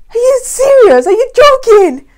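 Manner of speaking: high, loud stunned disbelief; can't believe what he sees; husband seeing pregnancy test, high loud disbelief; shocked disbelief. Retold or imitated. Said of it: "and he was like".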